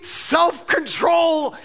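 high, loud, drawn-out cry; shouted theme word. Real, not acted. Preacher shouting the theme word to the congregation, slow and loud. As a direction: call it out slowly to the whole room.

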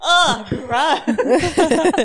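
a laughing, hammed-up "Ugh, gross."; mock disgust. Teasing the others for loving Cole, mock disgust with a laugh. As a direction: groan it out in fake revulsion while laughing.

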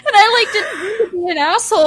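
words squeezed out through high laughter; laughing. Real, not acted. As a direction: talk while laughing, pitch up high. Laughing while retelling atheist remark.

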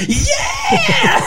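high, laughing shouted yeah; rock star yell. Pretend. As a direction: whoop it like a concert. Angel knocking them down again.

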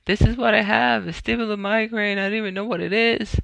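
high, tearful retold lament; imitated crying. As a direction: act out crying to family on the phone. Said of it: "calling my mom crying".

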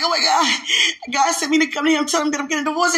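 high, worked-up outpouring; agitated retelling. Retold or imitated. Retelling a frantic message to her sister in Christ, agitated. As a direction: blurt it out, loud and high-pitched.

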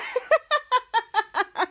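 high staccato laugh track; imitated laughter. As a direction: imitate the kids laughing at him. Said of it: "And there's laughter again".

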